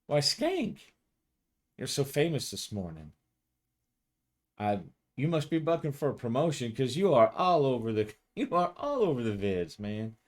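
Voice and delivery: a cheeky female cop ribbing her partner; teasing coworker. Acted. GM voices Kathy teasing.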